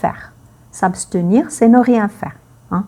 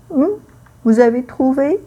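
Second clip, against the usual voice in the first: normal talking voice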